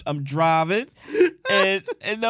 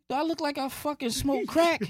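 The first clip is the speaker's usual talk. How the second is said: much higher, indignant rhetorical question; offended; 9 semitones higher, 3 dB quieter than usual. Retold or imitated. Him protesting to the crackhead, high and offended. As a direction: make it sound insulting.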